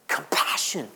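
whispered, soft answer; hushed tender whisper of compassion. Acted. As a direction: breathe the word out like a secret. Answer to his own question.

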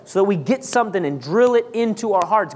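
ordinary talk, nothing marked

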